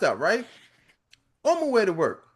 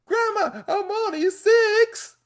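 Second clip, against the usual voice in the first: put-on a much higher, slow, whiny six-year-old; crying little boy; 10 semitones higher than usual. Six-year-old pleading with his grandma, high and whiny. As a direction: wail it like a small kid who can't take more. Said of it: "He's like".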